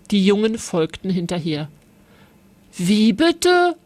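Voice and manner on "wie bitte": a sharp protest that fades on the second line; scolding. A narrator's reading. Elias complains to Lilly that Paul started it, scolding high. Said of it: "schimpfte Elias".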